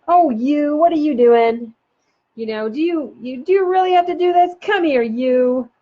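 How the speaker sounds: playful mock scolding before a head nuzzle; playful parent teasing. Shown as a demonstration. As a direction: tease the kid as you swoop in. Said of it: "even just coming in and going".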